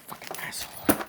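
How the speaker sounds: normal talking voice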